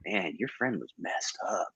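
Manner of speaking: whisper. Retold or imitated. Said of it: "and he's like".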